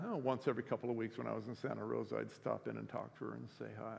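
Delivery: ordinary delivery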